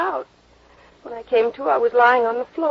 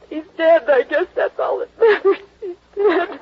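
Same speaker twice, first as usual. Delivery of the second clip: rising, tearful distress, higher and louder; breaking down; 8 semitones higher, 4 dB louder than usual. Acted. Woman over the dead man, breaking into tears. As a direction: voice climbing into a sob.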